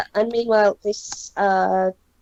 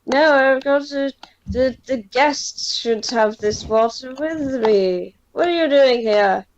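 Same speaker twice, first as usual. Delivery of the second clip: put-on drunk Shola; 3 semitones higher than usual. Drunk Shola insists guests share his water, higher and tipsy. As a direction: plead tipsily to keep the guests.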